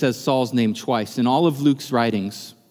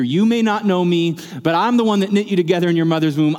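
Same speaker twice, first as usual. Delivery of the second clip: higher, intense voice of Jesus, pleading and personal; Jesus pleading with Saul; 5 semitones higher than usual. Acted. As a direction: plead with Saul as his maker.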